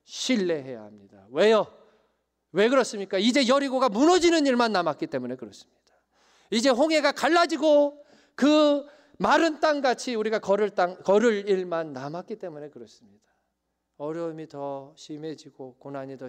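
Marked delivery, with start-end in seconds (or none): 1.3-5.8 loud, high, fast sermon climax; fiery preaching. Acted. Preacher rising to full force. 6.5-13.2 loud, high, fast sermon climax; fiery preaching. Acted. Preacher at full force.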